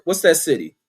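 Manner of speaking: usual speaking voice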